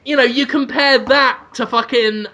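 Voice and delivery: normal talking voice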